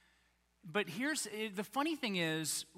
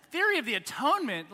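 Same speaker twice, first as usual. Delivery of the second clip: high, loud, quick baffled echo; incredulous; 5 semitones higher, 6 dB louder, faster than usual. Acted. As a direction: throw it back in mock disbelief, high and loud. Baffled listener echoes the question.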